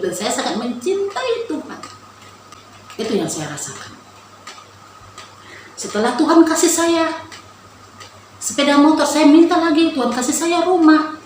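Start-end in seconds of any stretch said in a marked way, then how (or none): 5.8-11.1 higher and louder, swept up in the story; excited. Real, not acted. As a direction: lift it with growing fervour. Excited testimony of asking more.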